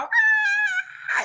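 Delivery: high yelled cry to get his attention; yell for brother. Retold or imitated. Girl yelling to her brother across the parking lot, high cry.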